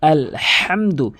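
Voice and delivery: "আলহামদু" with a strong h from mid-throat, slow; throaty h. Shown as a demonstration. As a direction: stretch "আলহামদু", pushing the h from the middle of the throat. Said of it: "এভাবে উচ্চারণ করবেন". (গলার মাঝখান থেকে হা দিয়ে ধীরে "আলহামদু")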